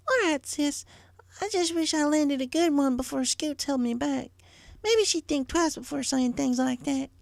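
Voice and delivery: put-on very high, young filly voice; a curious little sister, voiced high. A narrator's reading. Little sister asking big sister, young high voice.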